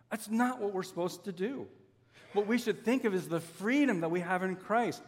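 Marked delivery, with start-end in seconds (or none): none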